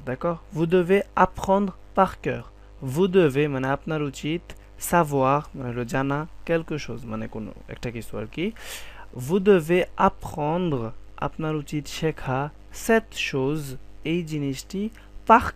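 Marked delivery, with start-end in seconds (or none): 0.6-2.4 slow, louder teaching sentence; teacher demo; slow emphatic model. Shown as a demonstration. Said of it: "teacher models French". 9.2-10.9 teacher demo; slow emphatic model. Shown as a demonstration. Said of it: "teacher models French".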